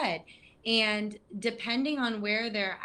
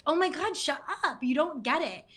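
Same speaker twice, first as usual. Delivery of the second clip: high, fed-up mock reply; exasperated retort; 7 semitones higher than usual. Depressed person snapping at an overly upbeat friend, exasperated. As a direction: sound done with their cheerfulness.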